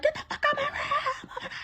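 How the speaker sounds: high-pitched, drawn-out mock yell; mom yelling. Mom catching daughter in her room, high mock yelling. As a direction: imitate an angry mom in a high, stretched voice. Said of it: "hear my mom yelling at my sister".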